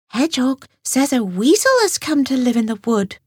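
a touch of alarm in the news; lightly voiced anxious rabbit. A narrator's reading. Grey Rabbit reports news.

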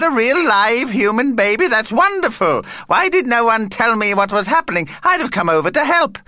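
high-pitched vampire bursting with delight; excitable vampire. Acted. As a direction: gush in a high, over-eager character voice. Vampire Vlad, thrilled by news.

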